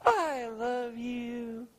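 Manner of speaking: slow, stretched-out imitation of a child; long, lingering, sweet delivery; little kid's sweet declaration. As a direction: coo it like an adoring little kid. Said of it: "this little kid walking up".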